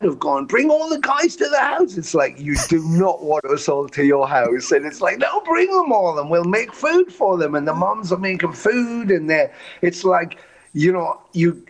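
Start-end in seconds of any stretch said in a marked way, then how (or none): 0.5-1.9 a high, eager parent's invitation; welcoming parent. Retold or imitated. A band member's parent welcoming the whole band, eager and high. 5.2-7.5 a high, eager parent's invitation; welcoming parent. Retold or imitated. A band member's parent welcoming the whole band, eager and high.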